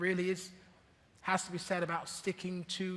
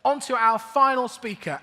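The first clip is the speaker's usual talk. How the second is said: raised, projected host voice; announcing loudly; 5 semitones higher, 11 dB louder than usual. Real, not acted. Host moving on to the final speaker, announcing loudly. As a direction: call the room back to attention. Host calls the room to order.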